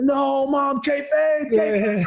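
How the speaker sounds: high-pitched comic dismay; mock panic. Son protesting to his mother on the phone, in high mock panic. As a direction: plead in hammed-up alarm. Retold dismay at mom's slip.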